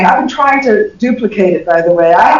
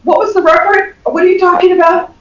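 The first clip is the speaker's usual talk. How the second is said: confused listener; 8 semitones higher than usual. Retold or imitated. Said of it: "I was like".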